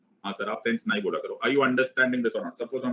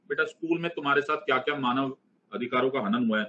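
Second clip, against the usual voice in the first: normal talking voice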